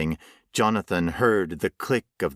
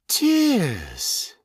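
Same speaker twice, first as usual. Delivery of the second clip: crowing; 10 semitones higher than usual. Acted. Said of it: "the creature crowed".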